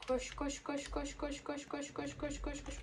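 soft, rhythmic chant under the breath; hushed urging. Real, not acted. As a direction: urge yourself along very quietly. Oyunda koşarken kendi kendine tekrarlıyor. (alçak sesle tempolu mırıldanma)

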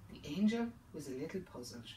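ordinary delivery, nothing marked

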